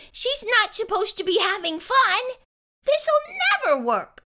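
put-on high, exasperated child's complaint; blurted dismay. A narrator's reading. Child seeing Emma have fun, high dismayed blurt. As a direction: protest with the pitch way up. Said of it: "I blurted".

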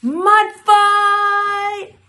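high, long sustained shout; battle cry. A narrator's reading. Storyteller launching the mud fight, high drawn-out yell. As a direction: call it out loud, holding the last word.

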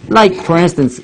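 ordinary talk

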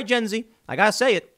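ordinary talk, nothing marked

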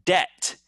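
"debt" said as det; "debt" with no B sound; silent B. Shown as a demonstration. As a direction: go straight from vowel to T in "debt". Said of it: "We say like D E T".

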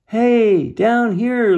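high, loud storybook holler; calling out. A narrator's reading. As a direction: call up to someone far overhead. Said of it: "Lucas called".

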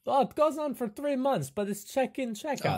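high-pitched host explaining; breezy sales patter in character; eager camp host. Acted. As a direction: reassure them eagerly.

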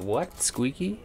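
soft, high-pitched mock disbelief; hushed squeak of a mock question; imitating Craig. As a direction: quietly mimic a puzzled reaction, pitch leaping up on the last word. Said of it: "Craig's just be like".

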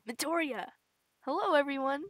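normal talking voice

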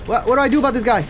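spiralling panic, voice shooting up; panicked. Real, not acted. Panicking at unknown robot.

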